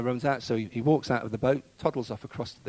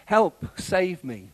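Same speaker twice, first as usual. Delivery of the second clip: slow cry, raised and louder; Peter's cry for help; 5 semitones higher, 5 dB louder, much slower than usual. A narrator's reading. Peter sinking and crying to Jesus, drawn-out call for help. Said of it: "Peter cried".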